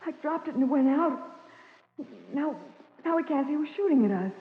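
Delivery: high, panicky breathless words; frightened. Acted. Patsy, under fire in the cave, cries out in fear. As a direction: gasp it out, scared in the dark.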